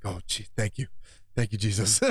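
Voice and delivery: grateful sigh of relief, lightly mocked.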